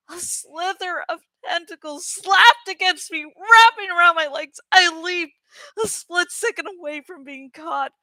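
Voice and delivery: usual speaking voice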